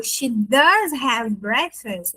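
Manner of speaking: "does" stretched and punched in "She does have breakfast"; slow sentence leaning hard on "does"; emphatic does. Shown as a demonstration. Teacher insisting she does eat breakfast, stressed does. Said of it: "eu tô dizendo que ela toma café da manhã, sim".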